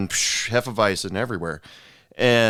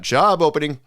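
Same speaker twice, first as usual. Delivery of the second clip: blurted out; 7 semitones higher, 4 dB louder than usual. Retold or imitated. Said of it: "she decided to blurt out".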